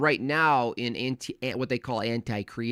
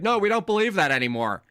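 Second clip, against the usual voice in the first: higher, smug dismissive mimicry; 8 semitones higher, 4 dB louder than usual. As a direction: parody them brushing it off. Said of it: "They're like".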